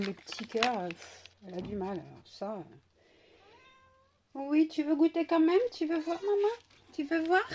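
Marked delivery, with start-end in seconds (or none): 4.3-7.5 high, lifted sing-to-the-pet questions; excited pet talk. Real, not acted. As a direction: coax the pet along, pitched well up. Coaxing her pet to taste. (voix aiguë et enjouée pour parler à son animal)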